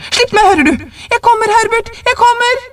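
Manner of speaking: very high, desperate mother's cry; frantic calling out. A narrator's reading. Wife struggles loose and calls to her son, frantic high cry. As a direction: call urgently to someone outside, pitched high.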